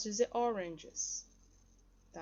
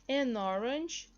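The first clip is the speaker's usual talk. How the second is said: slow, drawn-out "An orange"; slow model pronunciation; 3 semitones higher, 4 dB louder, much slower than usual. Shown as a demonstration. Teacher presenting an example to learners, slow model pronunciation. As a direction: say "An orange" at half pace, emphatically.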